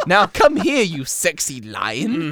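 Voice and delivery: high, loud, teasing purr; seductive witch. Acted. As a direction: camp it up as a flirty witch. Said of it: "seductive witch joke line".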